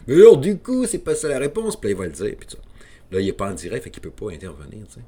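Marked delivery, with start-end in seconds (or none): 0.1-1.8 loud high-pitched imitation of a co-host; imitating Jérôme. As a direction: cut in loud and high like a co-host correcting him. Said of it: "Jérôme intervient en disant".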